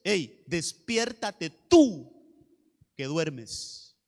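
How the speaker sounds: very slow summons, dropping at the end; drawn out, then sinking low; deliberate wake-up call. Acted. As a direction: rouse them slowly, word by word. Acts out waking a sleeper.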